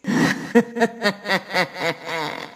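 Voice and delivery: ghostly female laugh, soft and distant; a spooky laugh from the darkness; eerie woman's laugh. Acted. An unseen woman laughs at the two killers, eerie.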